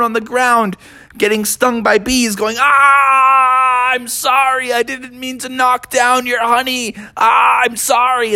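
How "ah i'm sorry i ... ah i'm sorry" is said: high, hammed-up pleading wail; wailing apology. Stung players begging the bees, wailing. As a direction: cry out sorry as if being stung.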